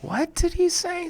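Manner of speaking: high, distant confused squeak; panicked squeak. Acted. Nervous Asad hearing nonsense, high panicked squeak. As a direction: sound lost and panicky. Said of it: "so nervous that he hears nonsense".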